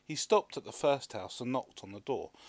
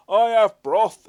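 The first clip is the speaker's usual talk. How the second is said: loud, slow and higher exclamation; neighbour exclaiming; 11 semitones higher, 20 dB louder, slower than usual. A narrator's reading. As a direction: shout the offer proudly. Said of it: "exclaimed another neighbour".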